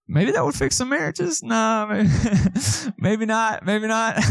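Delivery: amused chuckle; laughing. Real, not acted. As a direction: laugh it off as you say it. Joking about marriage, laughing.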